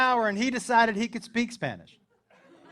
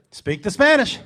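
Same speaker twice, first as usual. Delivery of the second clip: loud, deliberate order; commanding; 3 semitones higher, 11 dB louder than usual. Retold or imitated. Dad to son at the border, loud command. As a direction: give the order loudly, like unleashing a weapon.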